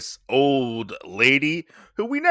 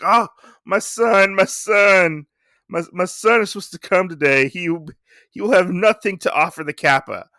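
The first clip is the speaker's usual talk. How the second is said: imitating crying; 7 semitones higher, 4 dB louder than usual. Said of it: "she bursts out and starts crying".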